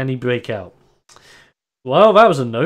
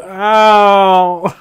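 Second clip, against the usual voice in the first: higher, louder yelp of pain; 6 semitones higher, 6 dB louder than usual. Acted. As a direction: yelp landing on his back. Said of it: "you just hear him go".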